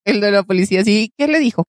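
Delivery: normal talking voice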